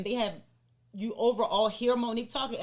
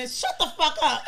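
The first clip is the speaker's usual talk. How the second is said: loud, higher laughing shut up; laughing yell; 6 semitones higher, 6 dB louder than usual. Retold or imitated. Telling her friend to hush on camera, a loud laughing yell. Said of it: "So what you see is me saying".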